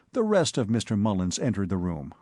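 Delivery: plain reading voice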